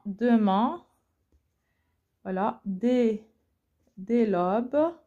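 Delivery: slow, halting, with long pauses between the chunks; slow syllable count. Shown as a demonstration. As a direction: scan the line piece by piece, like a teacher. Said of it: "je vais voir avec vous comment, on découpe un vers en syllabes".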